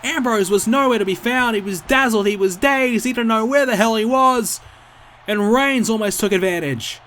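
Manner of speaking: loud, high near-fall call; wrestling commentator. Real, not acted. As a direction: scream the near-fall, peak on it's over. Near-fall during live match call.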